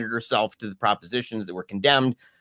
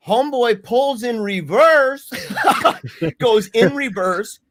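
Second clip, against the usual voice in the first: laughing; 9 semitones higher, 4 dB louder, slower than usual. Real, not acted. Laughing retelling chase.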